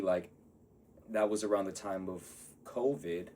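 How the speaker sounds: usual speaking voice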